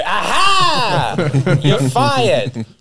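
a sudden high, loud "Aha!" then a gleeful sacking; spring the trap with a loud, high cry; triumphant gotcha. Retold or imitated. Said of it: "And you go".

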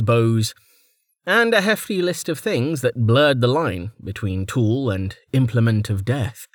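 plain reading voice

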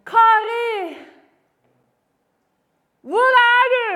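loud searching call, name first, then question. Acted. Holy Spirit seeking a straying believer, calling out. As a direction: shout for someone who is lost. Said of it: "han begynner å si sånn".

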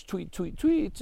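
high little chirps; bird tweet. Acted. Robin Bird's only words, chirped high. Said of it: "would say was".

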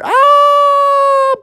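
long, very high mock scream; sustained high wail; falling scream. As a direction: scream as if dropping through the floor. Everyone falling through melted floor.

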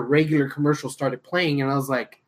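ordinary delivery

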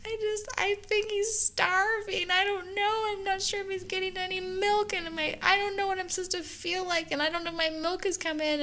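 high, worried retold voice of a panicked new mom; panicked tearful self. New mom tells her friend she fears starving baby, high and anxious.

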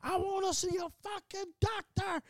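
very high, strained mock holler; imitated yelling. ER patient demanding a doctor, imitated high yell. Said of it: "He's just yelling".

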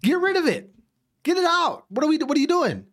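high-pitched ranting plea; exasperated demand. Acted. Loyal customer telling the cafe to remove its shield, exasperated. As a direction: pitch jumps up in comic outrage.

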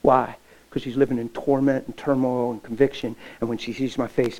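ordinary talk, nothing marked

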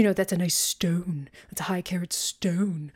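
put-on fancy hushed posh accent. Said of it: "say it with that accent there".

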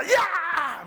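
a loud high "¡ya!"; sudden yelled ya; sharp shout. Retold or imitated. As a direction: bark it like kicking the door in. Joke: kicking the door in.

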